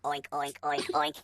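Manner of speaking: low grunting pig voice. Pretend. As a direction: grunt it like a piggy. Piggy in a children's song.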